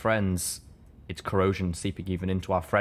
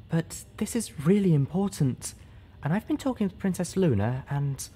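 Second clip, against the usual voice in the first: higher, earnest, fading; earnest shy speaker; 9 semitones higher than usual. Acted. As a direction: keep the speech going. Velvet's speech.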